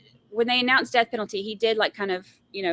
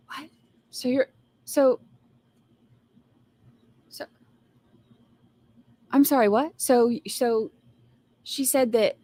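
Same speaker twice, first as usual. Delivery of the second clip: dramatic defense lawyer. Retold or imitated. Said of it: "He gets so dramatic with it".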